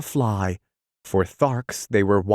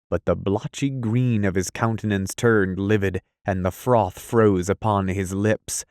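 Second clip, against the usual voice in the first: usual speaking voice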